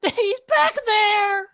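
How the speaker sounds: very high, loud, drawn-out squeal; high-pitched frightened kobold shout; terrified kobold. Acted. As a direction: give it up in a panicked little creature voice. GM voices intimidated, pinned kobold.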